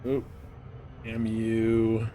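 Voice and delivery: ordinary talk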